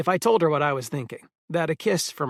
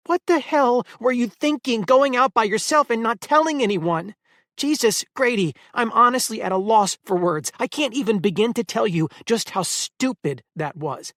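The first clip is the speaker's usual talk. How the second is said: high, loud furious scolding; yelling; 7 semitones higher, 5 dB louder than usual. A narrator's reading. Eleanor scolding Grady for going out alone, loud angry yelling. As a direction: let him have it, voice raised. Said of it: "Her yelling caught me by surprise".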